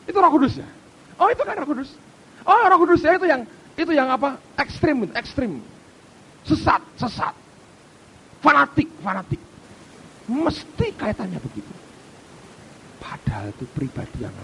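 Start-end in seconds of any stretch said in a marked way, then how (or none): 0.1-9.4 higher, louder sneering mock labels; mocking imitation. Preacher mimics critics labelling believers, sneering. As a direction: spit out the labels like a scoffer.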